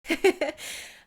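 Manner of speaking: loud, high half-laugh half-sob; breaking down then laughing at it; emotional outburst. Real, not acted. Vlogger breaking down on camera, loud tearful laugh. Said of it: "I'm gonna leave that in there".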